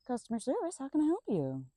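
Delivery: high chirpy phone-operator greeting; customer service voice. Pretend. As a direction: put on a bright call-centre voice. Mock cheerful phone greeting.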